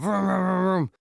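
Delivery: mock engine revving vroom; car engine vroom. Storyteller mimicking cars speeding off, a light vroom. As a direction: rev it like cars peeling away.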